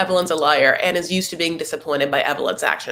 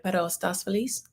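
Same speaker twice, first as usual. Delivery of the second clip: soft mother asking; 10 dB quieter than usual. Retold or imitated. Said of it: "the mom is like".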